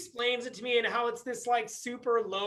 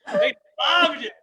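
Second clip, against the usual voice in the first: excited repeat; 7 semitones higher, 8 dB louder than usual. Real, not acted. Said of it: "repeated louder".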